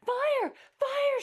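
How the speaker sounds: very loud, high scream; bathing woman screaming fire. A narrator's reading. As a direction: shriek in terror. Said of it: "she screamed".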